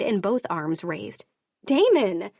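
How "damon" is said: loud, high alarmed cry of "Damon!"; shouting his name. Acted. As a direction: yell "Damon!" to stop his attack. Heroine yells to stop fight.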